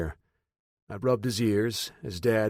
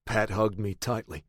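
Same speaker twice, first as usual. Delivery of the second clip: plain reading voice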